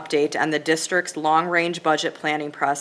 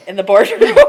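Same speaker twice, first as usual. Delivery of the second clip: laughing; 3 semitones higher, 10 dB louder than usual. Real, not acted. Laughing after announcing a sneeze.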